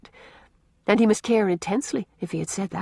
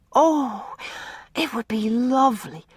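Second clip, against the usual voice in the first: put-on higher, joyful girl's exclamation; overjoyed little girl; delighted cry; 5 semitones higher, slower than usual. A narrator's reading. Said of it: "cried Una".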